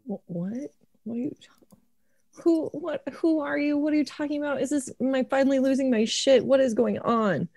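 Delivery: stammering terror at a voice; frightened confusion. Acted. Elliot asks the woods voice who it is, frightened.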